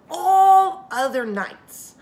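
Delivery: ALL other nights, slow and loud, rising high; drawn out. Shown as a demonstration. To her young listeners, slow emphatic ALL other nights. Dramatic emphasis on the famous phrase.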